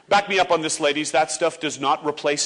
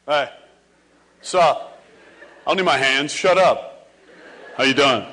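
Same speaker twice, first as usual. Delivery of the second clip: bro greeting; 4 semitones lower, 3 dB louder than usual. Retold or imitated. Guys' chin-wave greeting.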